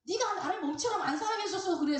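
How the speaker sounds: acted wifely retort. Wife blaming her husband for not loving her, acted retort.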